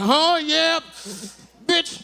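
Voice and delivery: high, hammed-up weak comeback, nearly crying. To the bully, a high mock-tough comeback. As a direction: squeak out a feeble insult. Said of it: "So I'm just standing there going".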